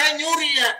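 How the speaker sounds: angry accusation. Retold or imitated. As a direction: accuse the fruit-picker sharply. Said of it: "Jangan pakai marah". (menuduh tetangga mencuri dengan marah, suara tinggi dan keras)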